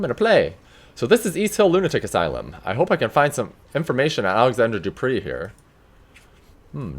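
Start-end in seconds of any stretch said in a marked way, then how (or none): none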